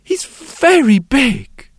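put-on high, slow child's voice; proud little girl boasting; bright little girl. The girl describes her daddy to the sculptor, high and slow. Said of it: "brightening up at once".

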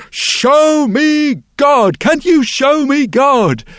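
high, loud and slow, almost pleading; wild loud plea. Acted. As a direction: call out like a wild-eyed young man. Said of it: "looks kinda wild-eyed and strange".

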